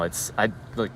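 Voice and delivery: ordinary talk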